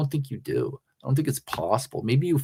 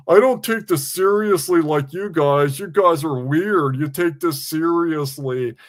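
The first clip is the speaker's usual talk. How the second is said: high, slow smug mock voice; 4 semitones higher, 3 dB louder than usual. Streamer parroting JT's dismissive words. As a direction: drag it out smugly.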